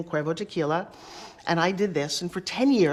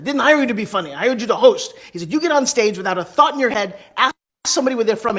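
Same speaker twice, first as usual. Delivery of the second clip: loud, raised, emphatic telling-off; imitated scolding; 3 semitones higher, 7 dB louder than usual. Club owner correcting his new host, loud and exasperated. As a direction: play the exasperated boss laying down orders.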